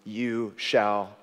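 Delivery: very slow, soft absolution; a pastor's quiet forgiveness; gentle pardon. Retold or imitated. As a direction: grant mercy tenderly. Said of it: "Nathan said".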